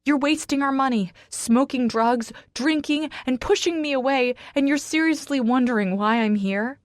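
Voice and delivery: high, loud yell. A narrator's reading. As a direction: shout the accusations at him. Yelling at husband.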